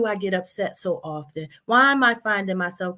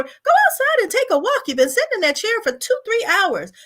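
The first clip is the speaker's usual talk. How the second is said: high, louder mock telling-off; scolding self-talk; 11 semitones higher, 4 dB louder than usual. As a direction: nag yourself to get up and move. Acted scolding of herself.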